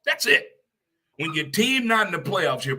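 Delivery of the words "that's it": emphatic. Real, not acted. Closing list emphatically.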